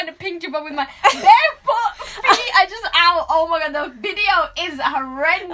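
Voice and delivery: high, loud voice breaking into laughter; laughing hard. Real, not acted. Confessing the horrendous video to a friend, laughing loudly. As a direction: tell it while helpless with laughter. Cracking up at embarrassing memory.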